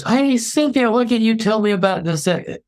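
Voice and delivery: high-pitched, eager questioner; nosy acquaintance. Acted. Shopper quizzing an employee in the supermarket, high and eager. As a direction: pipe up high, fishing for news.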